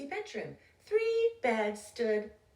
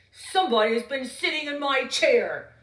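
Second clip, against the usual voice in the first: a hint of a big loud bear, louder; growly, loud Papa Bear; 3 semitones higher, 8 dB louder than usual. Papa Bear finding his porridge eaten, loud growl. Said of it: "he said in a loud, growly voice".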